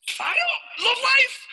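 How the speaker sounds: very high shouted exclamation; hammed-up fired-up yell; manic hype. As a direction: holler it high, bursting with energy. Answers bystander in hyped character.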